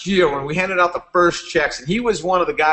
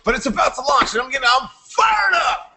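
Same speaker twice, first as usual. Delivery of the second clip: higher, shouting with hype; fired-up Aaron; 9 semitones higher than usual. Retold or imitated. Aaron insisting the company is about to launch, excited shout. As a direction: sell it like you can't contain yourself. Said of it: "Parker jacked out of his mind".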